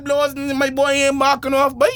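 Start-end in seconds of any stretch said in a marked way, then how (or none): none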